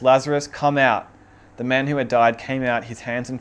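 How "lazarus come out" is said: loud call. A narrator's reading. Jesus calls Lazarus from the tomb, loud cry. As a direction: call the name out loud and firm. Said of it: "he cried out in a loud voice".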